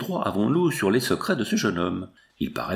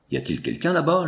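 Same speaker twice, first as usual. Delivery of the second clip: mild, higher-pitched call; 6 semitones higher than usual. A narrator's reading. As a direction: call down to someone unseen, without shouting. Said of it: "lui cria la princesse". (appel discret, d'une voix plus aiguë)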